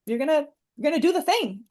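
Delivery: imitating a teacher who won't take no; insistent teacher. Teacher pushing a shy student to speak, insistent. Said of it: "this teacher, Mister Plaza, was like".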